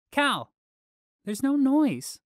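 put-on high, loud little boy; young boy calling out loudly; child voice, loud. A narrator's reading. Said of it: "Flick spoke loudly".

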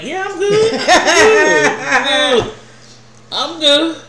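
laughing it off. Real, not acted. Teller tells Ray she's fine while laughing.

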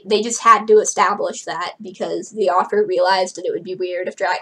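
ordinary delivery, nothing marked

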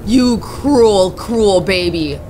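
low, slow contempt; bitter low insult. Real, not acted. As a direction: drag it out with scorn.

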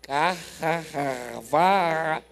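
throat cleared, then the name drawn out very slowly; slow emphatic name. Retold or imitated. Preacher slowly spelling out Carvalho to the puzzled woman. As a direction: stretch out Carvalho as if she will finally understand. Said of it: "pela terceira vez ela disse".

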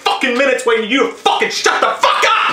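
rising to a laughing yell; exasperated outburst. Real, not acted. Bursting out after waiting.

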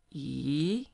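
E rising upward; second tone e. Shown as a demonstration. Teacher models the second tone, rising. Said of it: "is going up".

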